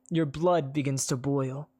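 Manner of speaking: ordinary delivery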